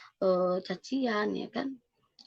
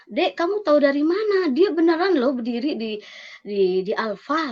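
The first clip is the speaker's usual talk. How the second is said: raised, louder voice, pressing for an answer; astonished sister; 7 semitones higher, 7 dB louder than usual. Retold or imitated. Sister rushes to the house to question her younger sibling, astonished. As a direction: imitate an incredulous older sister, high and loud.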